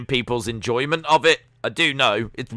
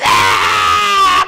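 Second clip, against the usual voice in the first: mock scream; 6 semitones lower, 8 dB louder than usual. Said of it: "She's like".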